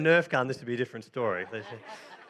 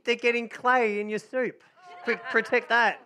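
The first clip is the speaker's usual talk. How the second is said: mock alarm; 6 semitones higher, slower than usual. Narrator warning Doreen about clay in her soup, startled. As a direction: warn Doreen her soup's in danger.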